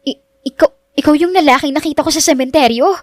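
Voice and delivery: high, stammered shock; gasp, stumble on the first word, pitch up; stunned and disbelieving; gasping realisation. A narrator's reading. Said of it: "kasabay ng pagsinghap niya".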